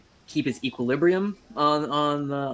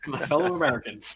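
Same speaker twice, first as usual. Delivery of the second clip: Obama impression; faster than usual. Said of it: "sound like Obama".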